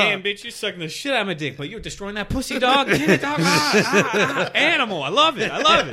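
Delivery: gas up your buddy mid-act; hype man. Retold or imitated. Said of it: "I'd be like".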